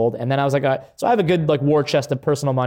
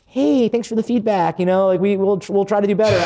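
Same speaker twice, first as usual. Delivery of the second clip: polite brand reply; 5 semitones higher than usual. Retold or imitated. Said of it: "most people would be like".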